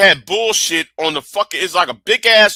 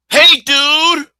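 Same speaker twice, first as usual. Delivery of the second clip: high, loud California surfer dude greeting; California accent; 10 semitones higher, 4 dB louder than usual. Retold or imitated. Austin locals greeting like Californians, in a loud, high California accent. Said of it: "you hear that California accent".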